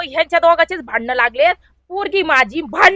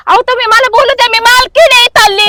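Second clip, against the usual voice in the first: higher-pitched, indignant protest; 6 semitones higher than usual. Acted. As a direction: cut in as the boss demanding a hearing. Boss demands to be heard.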